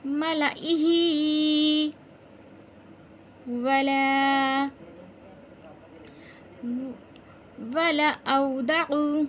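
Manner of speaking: loud, very slow chant; recitation demo. Shown as a demonstration. Teacher showing how to read it, loud slow recitation. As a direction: hold the long syllables, strong teaching voice. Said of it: "इसको कैसे पढ़ेंगे?".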